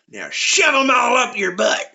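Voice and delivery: high-pitched hillbilly order; old farmer. Acted. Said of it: "The old man looks at him and goes".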